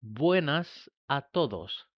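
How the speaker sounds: "Buenas a todos" spoken very slowly and clearly; slow, careful classroom greeting; slow demo. Shown as a demonstration. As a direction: model each word slowly for learners. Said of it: "Fijaros cómo voy pronunciando".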